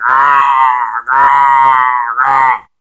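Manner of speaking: long muffled "aah" held at speaking pitch; mock agonized moans, drawn out; imitated pain groan. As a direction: groan as if trapped in a hold. Imitating wrestler screaming in ankle lock.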